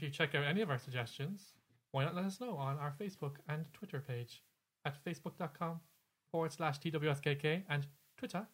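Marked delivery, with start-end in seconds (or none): 4.8-8.4 put-on half-hearted British accent. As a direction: read the handles in a jokey British accent. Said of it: "you're doing a British accent?".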